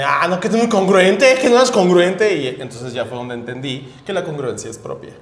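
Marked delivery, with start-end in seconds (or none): 0.0-2.3 high, loud, slow mock indignation; imitating outraged commenters; mocking the crowd. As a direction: parrot them in a hammed-up huff. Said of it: "todos fueron como de".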